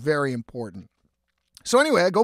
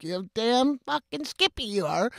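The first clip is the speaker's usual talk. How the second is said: higher, put-on cocky voice; mock cocky retort; 7 semitones higher than usual. Narrator answering the kids' offer, put-on cocky voice. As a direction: toss it back like a smug old-timer.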